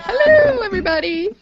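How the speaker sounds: very high squeaky puppet voice; bobblehead voice. Pretend. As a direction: squeak like a little doll. Bobblehead given a voice.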